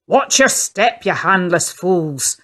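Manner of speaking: put-on an old sailor snapping at workmen to watch out; old man barking. A narrator's reading. Grumpy old sailor barks at the movers, loud. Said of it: "barks an old man with a thunderous frown".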